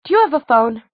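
eager interruption; interrupting. A narrator's reading. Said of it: "Clary interrupted".

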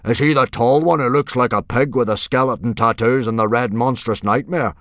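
a hint of a booming rival chief; blustering chieftain. A narrator's reading. Chieftain quizzing his rival about his son, lightly blustering.

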